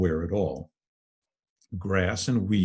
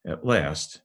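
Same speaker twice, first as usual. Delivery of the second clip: usual speaking voice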